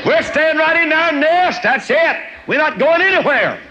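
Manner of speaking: high, loud squeaky eaglet refusing to budge; stubborn eaglet. Retold or imitated. Eaglet refusing to leave the nest, high loud stubborn voice. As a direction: dig in like a lazy child who won't leave home.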